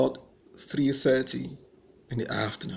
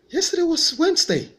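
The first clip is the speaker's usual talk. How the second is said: high, loud disbelief; incredulous protest; 5 dB louder than usual. Retold or imitated. Protesting to friends who say it's Friday, high incredulous. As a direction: insist on it in disbelief.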